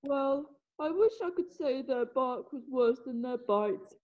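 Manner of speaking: ordinary talk, nothing marked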